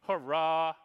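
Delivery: loud high cheer, hammed up; big theatrical hooray; mock cheer. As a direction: shout it like a party popper. Reading an excited post aloud.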